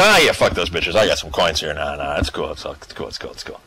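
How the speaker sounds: dismissive guard. Retold or imitated. Dock men pocketing coins and waving it off, fading to a whisper. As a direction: brush it off, then let it die away to a whisper. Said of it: "they go".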